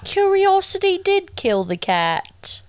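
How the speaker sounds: high-pitched fake British; mock British accent. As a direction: put on a silly British lilt. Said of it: "wish we had a British accent".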